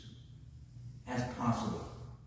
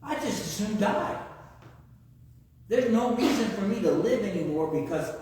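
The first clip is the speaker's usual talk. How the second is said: lightly acted despair, higher pitch; mock despair; 5 semitones higher than usual. Preacher voices upset Jonah wishing to die, mildly distraught. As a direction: play a touch of upset exasperation.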